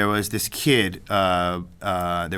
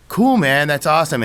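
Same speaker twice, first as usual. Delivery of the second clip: cool man, louder and raised; hearty mock praise; 6 semitones higher, 7 dB louder than usual. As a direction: pour on the enthusiasm. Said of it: "he's like".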